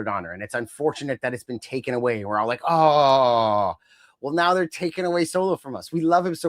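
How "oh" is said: loud drawn-out group aww; a fan's exaggerated aww; mock disappointment. Fan voicing everyone losing Chelsea's act, mock disappointment. Acting out fans' shared disappointment.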